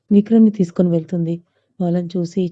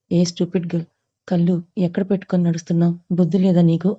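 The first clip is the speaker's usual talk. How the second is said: plain reading voice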